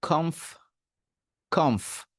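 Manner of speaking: কমফ as one syllable, aspirated k and airy final f; first part "kumf". Shown as a demonstration. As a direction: say কমফ as "khomf", air out on the f. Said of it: "এইটাকে বলবেন". (কমফ, শেষে ফ-এ বাতাস)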